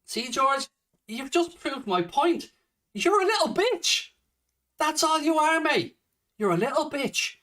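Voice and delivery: high-pitched, contemptuous jeer; angry taunt. Real, not acted. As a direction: taunt your online heckler, pitched high. Taunting the commenter after his reply.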